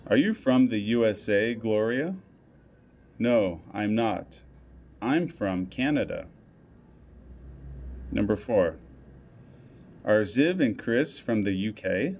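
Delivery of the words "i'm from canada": ordinary talk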